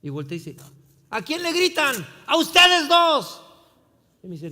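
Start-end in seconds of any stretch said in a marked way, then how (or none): 1.1-3.4 high, loud confrontational yell; full-volume street shouting, slowing at the end; imitated shouted challenge. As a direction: holler it across the street. Acted shouted challenge and answer across street.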